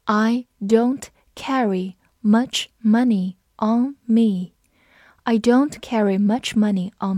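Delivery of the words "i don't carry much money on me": slow, careful reading of the sentence. Shown as a demonstration. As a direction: read each word slowly. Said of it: "我们慢读一遍".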